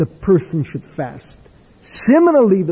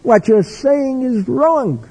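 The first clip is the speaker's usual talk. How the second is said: higher, slow and indignant, a retold outburst; imitating Reb Chaim's upset protest; hysterical objection; 7 semitones higher than usual. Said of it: "Reb Chaim becomes hysterical".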